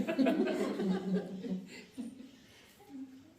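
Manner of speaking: bursting into a high, long laugh. Real, not acted. Telling the joke about the snake charmer, high laughing. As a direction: laugh out at your own joke. Laughs at his own punchline.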